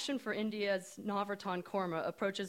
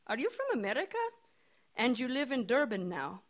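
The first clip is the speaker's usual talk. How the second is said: put-on curious Indian accent, pitched up; a puzzled Indian woman asking; 4 semitones higher than usual. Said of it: "she says in her strong Indian accent".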